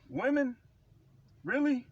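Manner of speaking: high, disbelieving question; incredulous. Real, not acted. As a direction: scoff at the very idea.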